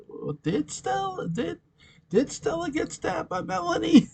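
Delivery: very high, squeaky put-on voice; high-pitched fake wondering; mock disbelief. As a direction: ask it in a tiny, hammy falsetto. Acting out a feigned shocked question.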